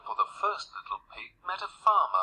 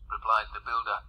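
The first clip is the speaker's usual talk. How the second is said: ordinary delivery, nothing marked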